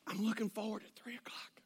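hushed, secretive whisper. Retold or imitated. Store clerk confiding over the counter, whispering. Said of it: "she whispers this".

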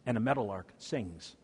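ordinary talk, nothing marked

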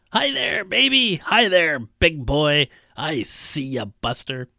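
put-on high, sing-song calls to a baby; drunk old man baby talk. Old drunk calling out to a baby in a diner, high sing-song. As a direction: coo loudly at the baby across the room.

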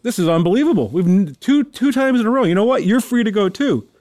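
mock disbelief, pitch jumping up; lightly acted astonishment; amazed warden. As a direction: marvel as the joke's warden. Warden amazed a second time.

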